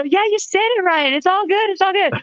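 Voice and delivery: high, breezy star voice; high, easygoing reassurance; celebrity voice. Retold or imitated. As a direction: play the gracious star. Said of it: "I go".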